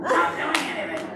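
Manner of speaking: Trish voice. Retold or imitated. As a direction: overdo the whiny grumble for a laugh. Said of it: "my Trish voice came on".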